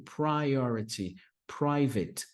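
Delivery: priority, private said slowly and clearly; slow, careful teacher model of priority and private; repeat after me. Shown as a demonstration. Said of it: "Fala aí comigo".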